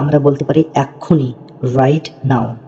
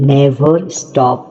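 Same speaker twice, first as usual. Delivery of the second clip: very slow model reading; much slower than usual. Shown as a demonstration. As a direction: try it out, each word drawn out very slowly. Teacher models English phrase slowly. (খুব ধীরে ধীরে স্পষ্ট উচ্চারণ)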